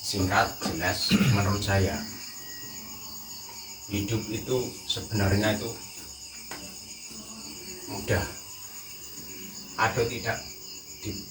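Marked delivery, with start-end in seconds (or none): none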